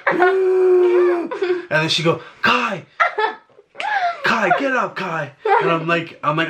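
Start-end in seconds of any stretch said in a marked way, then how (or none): 0.1-1.3 high, loud mock wail; one long hammed-up cry of agony; imitated pain scream. Partner mimicking her labor cries, hammed-up scream. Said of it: "It hurts to scream". 2.4-5.3 high-pitched mock plea to wake up; hammed-up, higher call of a name; imitated urgent calling. Partner mimicking her waking him at night, urgent calling.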